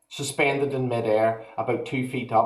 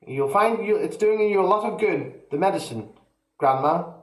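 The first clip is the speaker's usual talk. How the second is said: put-on higher boyish reassurance; calm boy; 7 semitones higher than usual. A narrator's reading. George reassuring grandma, higher. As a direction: brush it off like a cheeky lad.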